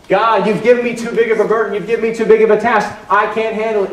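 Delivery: loud, high, pleading complaint; anguished cry to God. Acted. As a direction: cry out that the burden is too much. Voicing people crying out to God.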